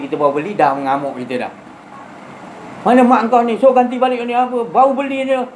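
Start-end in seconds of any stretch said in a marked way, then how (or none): 2.8-5.5 angry car owner. Acted. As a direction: snap at the kid over the new car. (marah, suara tinggi dan kuat menegur budak)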